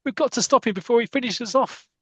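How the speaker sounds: panicked. Retold or imitated. Panic on runaway coach.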